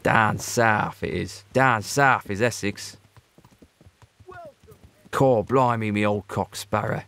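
slow, hammed-up Essex-London accent; terrible Essex accent. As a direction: do a deliberately bad southern English Essex accent. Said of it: "That is a terrible accent".

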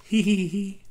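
high, light "hee hee hee" giggle. Acted. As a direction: giggle like a delighted little girl. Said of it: "And then Bluey laughs".

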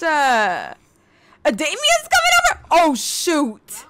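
very high, alarmed squeal. Real, not acted. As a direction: panic he'll catch her. Worried Damien's coming.

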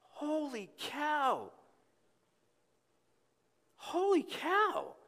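high, slow, drawn-out amazement; awestruck. Real, not acted. Preacher marveling at God living in us. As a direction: let the wonder stretch each word.

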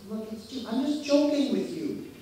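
high, loud, breezy dismissal; colleague brushing it off. Retold or imitated. Colleague waving off his complaint, high and breezy. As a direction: laugh it off as harmless teasing. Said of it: "she says".

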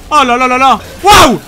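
high, loud exclamations; startled loud wow; alarmed. Real, not acted. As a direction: gasp at the boss's attack. Boss attacks.

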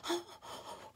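high, breathy cry of dismay; anguished cry. Acted. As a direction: gasp it out in horror. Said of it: "cried the girls".

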